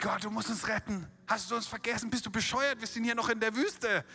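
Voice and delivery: hammered-up cry of a frightened leader; desperate cry to God. Retold or imitated. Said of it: "hingeschrien zu Gott".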